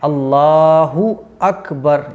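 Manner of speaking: अल्लाहु अकबर very slow, every syllable clean; slow, clear Allahu Akbar. Shown as a demonstration. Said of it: "इस गलती को सही करना है". (अल्लाहु अकबर बहुत धीरे और साफ़)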